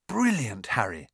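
usual speaking voice

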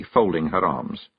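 usual speaking voice

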